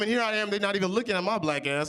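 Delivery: normal talking voice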